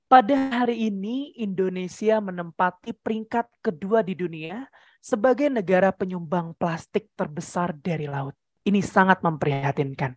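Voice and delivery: slow it down so the concern sinks in; soft, grave and unhurried; slow, serious tempo. Shown as a demonstration. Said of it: "berarti dilambatin temponya".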